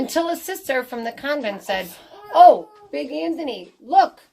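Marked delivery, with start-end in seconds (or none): 2.3-4.2 high and loud startled call; nun warning Big Anthony, startled exclamation; alarmed cry. Acted. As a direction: point out the disaster in alarm. Sister sees pasta overflowing.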